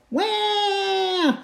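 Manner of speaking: put-on high drawn-out baby wah; baby wail. Baby Polly crying for food, high wail.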